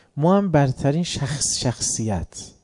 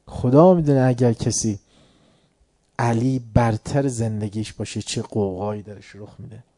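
normal talking voice

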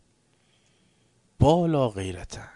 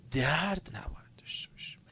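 whispered conclusion; 6 semitones higher, 4 dB quieter than usual. Acted. Preacher whispering advice to listeners. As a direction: whisper it firmly.

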